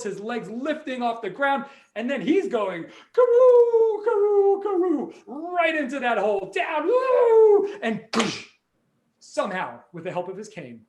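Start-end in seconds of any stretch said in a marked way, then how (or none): 3.1-7.7 very high, loud falling whoop. Acted. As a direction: shriek with glee as he drops. Old man falling into hole.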